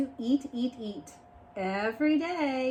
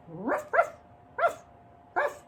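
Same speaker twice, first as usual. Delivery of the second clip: not-so-mean dog bark. Acted. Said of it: "I don't sound like a very mean dog".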